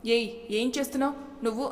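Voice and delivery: a hint of frightened alarm; lightly voiced panic at the glass shard; fearfully. A narrator's reading. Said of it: "భయంగా".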